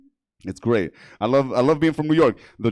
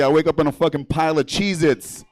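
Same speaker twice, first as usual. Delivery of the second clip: ordinary talk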